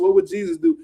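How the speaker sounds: high mocking challenge; incredulous outburst. Real, not acted. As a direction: pitch leaps up on would. Scolding rant at fighter.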